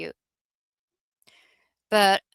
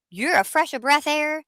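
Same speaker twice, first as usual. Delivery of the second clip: gushing compliment; 8 semitones higher than usual. Retold or imitated. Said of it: "I said".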